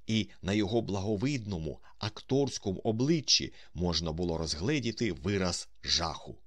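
ordinary delivery

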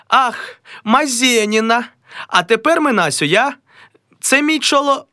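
high, delighted hostess; a high, gushing wife. Acted. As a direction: gush at the guests. Mazailykha rehearses introduction.

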